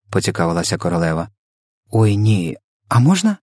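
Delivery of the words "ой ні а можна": put-on happy girlish eagerness; delighted. A narrator's reading. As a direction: leap up hopefully at the offer. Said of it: "зраділа Софія".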